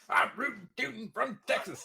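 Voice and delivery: hammed-up Yosemite Sam Texan bluster. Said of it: "just been Yosemite Sam".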